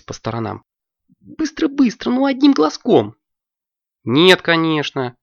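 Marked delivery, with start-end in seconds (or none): 1.2-3.2 high, squeaky and excited; a high child's voice begging to order fast; eager little girl. Acted. Алёнка begs. 4.0-5.2 indignant. Acted. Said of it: "возмутилась Варя".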